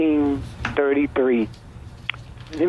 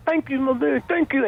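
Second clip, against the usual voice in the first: higher, overly friendly mobster thanking; mock mob thanks; 10 semitones higher than usual. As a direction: play the false gratitude of a gangster. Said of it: "The mob will thank".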